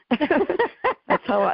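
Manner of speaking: giggling, high and drawn out; laughing. Real, not acted. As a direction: breaks into a laugh mid-sentence. Laughing at own remark.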